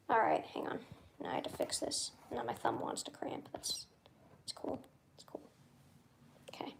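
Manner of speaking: hushed, near-silent aside; barely voiced, muttering to self; whispering. Real, not acted. Streamer whispering to himself while rigging his phone.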